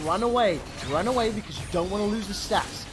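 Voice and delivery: ordinary talk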